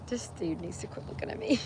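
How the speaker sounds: hushed aside under her breath; whisper. Real, not acted. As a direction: whisper so the man can't hear. Aside about a man nearby.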